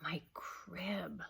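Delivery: soft whispered grumble; muttered. Acted. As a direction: mutter it to yourself.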